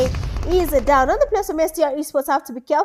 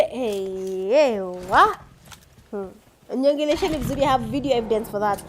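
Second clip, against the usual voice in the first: ordinary talk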